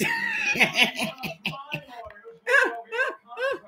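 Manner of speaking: shrieking laugh at the joke; high shrieking laugh. Real, not acted. Big laugh after joke.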